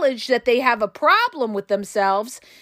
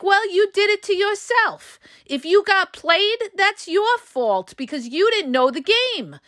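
raised, scornful mock narcissist voice, pitched well up; imitating a callous narcissist; 7 semitones higher than usual. Imitating a narcissist telling the victim they did it to themselves. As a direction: sneer the blame back at the victim. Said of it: "They feel like".

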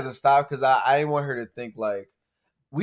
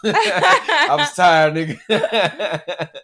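drawn-out, laughing, higher; laughing hard; 5 semitones higher, 8 dB louder, much slower than usual. Retold or imitated. Podcaster laughs retelling his tired reply to a coworker, very slow. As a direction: stretch it out while cracking up.

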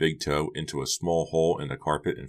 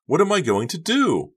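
very high, loud wail; desperate shriek; 13 semitones higher, 6 dB louder than usual. A narrator's reading. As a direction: shriek it out, out of control. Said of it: "Becca shrieked".

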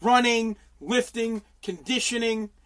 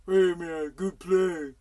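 mock polite sportsman; 5 semitones lower, 3 dB quieter, much slower than usual. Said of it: "have to be like".

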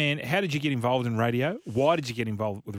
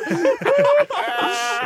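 high burst of laughter; 8 dB louder than usual. Real, not acted. Laughing at own delivery.

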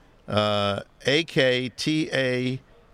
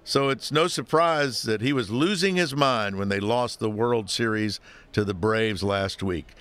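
ordinary talk

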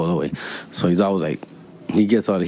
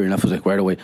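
ordinary talk, nothing marked

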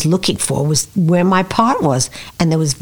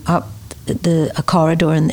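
usual speaking voice

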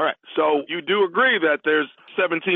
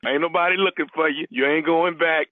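ordinary delivery

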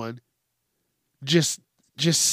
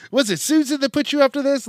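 much higher, fast, incredulous; high disbelieving prank reply; 11 semitones higher, 4 dB louder, faster than usual. Pretend. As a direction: act like it's a joke call. Photographer voiced, disbelieving prank reply.

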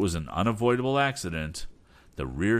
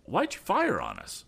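higher, mildly angry demand; angry shout; 7 semitones higher than usual. Acted. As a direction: agent yelling across water. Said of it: "shouted the agent angrily".

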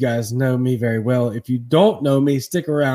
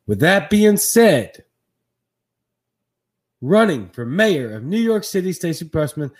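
announcer intro; 6 semitones higher, 3 dB louder than usual. Acted. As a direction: build it up like a show host. Host introduces the guest like an announcer.

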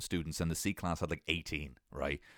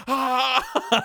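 high, loud hammed-up scream; mock screaming; 7 dB louder than usual. Dazed classmate waking up screaming at the teacher, mock loud. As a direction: scream like you just woke up terrified. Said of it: "the lad started screaming".

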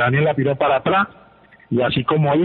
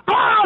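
high-pitched yelled "ah"; imitated shout. Coach yelling from the touchline, imitated shout. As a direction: mimic a yell from the sideline. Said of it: "me grita de ahí".

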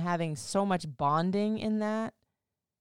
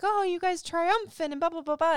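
high, gushing mock-admirer voice; overly sweet mock cheer; 11 semitones higher, 4 dB louder than usual. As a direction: parody the sugary praise, pitched well up. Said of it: "and some are like".

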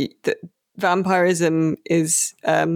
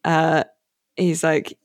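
ordinary talk

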